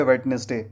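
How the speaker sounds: "वेडनेसडे" in three syllables, d sounded; common mispronunciation. Shown as a demonstration. Teacher shows how learners say it, wrong "wed-nes-day". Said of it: "वेडनेसडे उच्चारण बिल्कुल गलत है".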